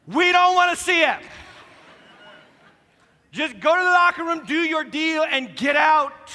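high, loud exasperated outburst; half-joking fed-up shout; preacher ranting about locker room selfies, loud exasperation. Acted. Exasperated rant at selfie-takers.

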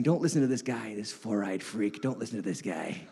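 low, soft voice of doubt; little inner voice. Acted. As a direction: quietly act the nagging voice inside. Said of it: "that little voice in the back of your head".

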